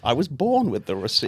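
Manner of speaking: lightly imitated masked villain; Bane. Feeding a line to the impressionist, Bane impression. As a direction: borrow the villain's lilt. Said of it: "Tom Hardy as Bane".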